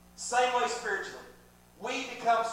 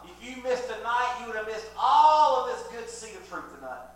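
preaching; 2 semitones higher, 7 dB louder than usual. Acted. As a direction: proclaim it from the pulpit. Preaching at full force.

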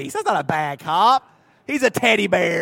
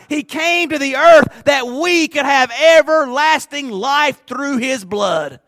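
loud, slow preaching climax; full-force preaching; 6 semitones higher, 6 dB louder, slower than usual. Real, not acted. Preacher telling the church why Jesus came, loud and slow. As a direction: drive the message home, louder and slower.

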